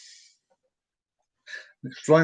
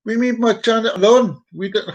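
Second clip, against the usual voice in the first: badly faked Chinese accent, higher; attempted Chinese accent; 6 semitones higher than usual. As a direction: have a failed go at a Chinese accent. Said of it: "I can't do Chinese either".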